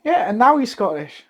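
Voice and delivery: high-pitched exasperated rant; worked up over a plot hole, pitch way up; worked-up panic. Real, not acted. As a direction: protest in a high, agitated voice. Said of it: "Don't panic".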